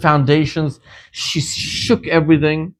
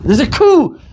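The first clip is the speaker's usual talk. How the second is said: high, loud alarm; sudden high alarmed shout; alarmed cry; 12 semitones higher, 6 dB louder than usual. Retold or imitated. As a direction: cry out the danger. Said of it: "She says".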